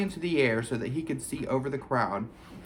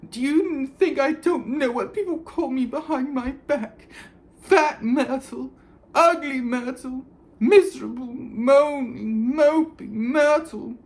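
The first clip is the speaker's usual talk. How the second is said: much higher, louder, tearful wail; crying Myrtle; 13 semitones higher, 6 dB louder than usual. A narrator's reading. Myrtle to the students, tearful and wailing. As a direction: wail it through tears.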